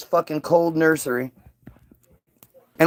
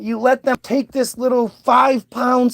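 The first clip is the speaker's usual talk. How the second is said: normal talking voice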